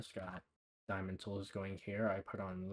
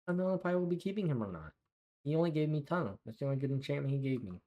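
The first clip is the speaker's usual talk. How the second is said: excited; 6 semitones higher, 6 dB louder, faster than usual. Real, not acted. Agitated over bad villager trades.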